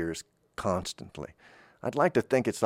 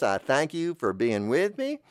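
ordinary talk, nothing marked

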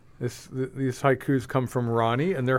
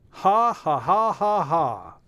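high mocking ha ha ha; mock laugh; 5 semitones higher, slower than usual. Reading the haiku's laugh line.

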